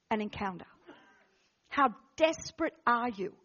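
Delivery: low, soft, weighty exhortation; hushed preaching appeal. Real, not acted. Preacher asking the congregation how desperate they are, hushed. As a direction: drop right down and let each question hang.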